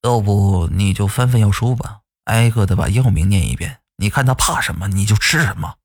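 low-pitched grandfather voice; advising grandfather. A narrator's reading. Grandfather advises his sick nephew on a cure, low grandfather voice. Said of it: "我姥爷说".

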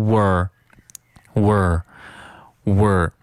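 "were" as one reduced syllable, "wur"; weakened form. Shown as a demonstration. Teacher shows learners the weak form, slow "were". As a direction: weaken we are to a drawn-out "were". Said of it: "读的也会弱一些".

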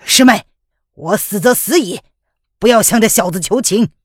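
loud, proud high cry; shouting. Acted. Wounded man shouting at his junior sister, loud and proud. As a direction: yell at her not to beg. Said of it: "范定峰叫道".

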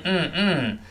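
"嗯" as a long hummed "n", mouth slowly closing; held final n. Shown as a demonstration. Teacher explains the end of fun, "嗯" as a sustained "n". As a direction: hum "嗯" through a nearly closed mouth. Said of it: "嘴要慢慢闭拢".

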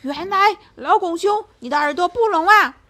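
high, loud, quick raging lion; lion finds out the bear can hear, furious; enraged. Acted. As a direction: rage at being tricked. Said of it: "狮子气得发狂".

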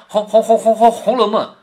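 imitating a stammerer stuck on the first syllable; stuttering. Shown as a demonstration. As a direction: stutter repeatedly on 红 before getting the word out. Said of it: "说话结结巴巴的". (模仿结巴，卡在“红”字上反复说)

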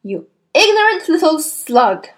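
bellowed. A narrator's reading. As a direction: roar the insult at the boy. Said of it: "the Trunchbull bellowed".

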